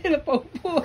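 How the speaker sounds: amused laughter breaking round the word; tickled, laughing hard. Real, not acted. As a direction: laugh as you say it. Child falls onto seat, parent laughs.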